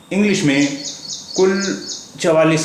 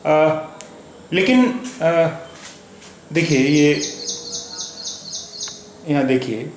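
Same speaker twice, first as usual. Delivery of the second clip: usual speaking voice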